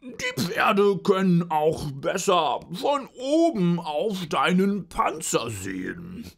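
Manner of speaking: sehr hoch, laut und langsam gejammert; eitler Pudel. Acted. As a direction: angeberischer Pudel, übertrieben klagend. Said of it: "sagte der Pudel". (eitler Pudel, sehr hoch)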